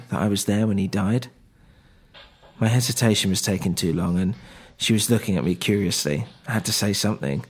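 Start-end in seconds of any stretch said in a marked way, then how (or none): none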